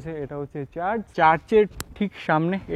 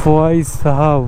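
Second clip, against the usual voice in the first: slow drawn-out "bhai saheb" call; mock greeting; 4 semitones lower, 6 dB louder, much slower than usual. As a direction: stretch it out like a playful greeting. Calls out in Hindi-style greeting.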